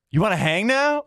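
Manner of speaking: very high incredulous question; mock disbelief. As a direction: ask it as if baffled by the turnaround. Acted disbelief at flirting heckler.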